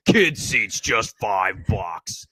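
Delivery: monster truck ad. Pretend. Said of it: "like a monster truck commercial".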